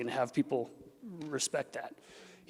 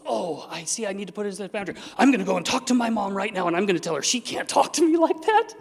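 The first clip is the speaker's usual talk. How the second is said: higher, louder, building indignation; fired-up resolve; 4 semitones higher, 5 dB louder than usual. Acted. Someone vowing to confront their mom, fired up. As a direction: build to a mock-indignant declaration. Acting out rash boundary setter.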